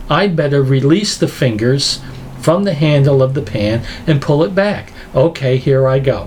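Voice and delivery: unhurried, measured mock reasoning. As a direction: think it through step by step, far too calmly. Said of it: "if you grab that and you go".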